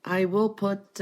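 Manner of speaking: usual speaking voice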